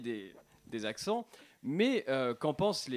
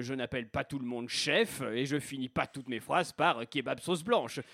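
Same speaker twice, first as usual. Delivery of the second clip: put-on low, softer North African accent; Maghrebi accent; 7 semitones lower, 5 dB quieter than usual. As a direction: plead your case in a Maghrebi accent, voice lowered. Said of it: "Je reçois accent maghrébin". (accent maghrébin, voix grave et posée)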